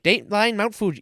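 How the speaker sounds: put-on quick sample of Mid-Atlantic old-timey speak; Mid-Atlantic accent. Shown as a demonstration. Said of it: "old-timey speak that we never hear anymore".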